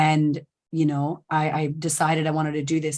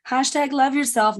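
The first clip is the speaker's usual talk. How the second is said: "hashtag love yourself" pitched much higher, scoffing; mocking hashtag; 8 semitones higher than usual. Shown as a demonstration. Coach mocking the shallow self-love slogan, pitched up. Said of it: "It's not what I mean".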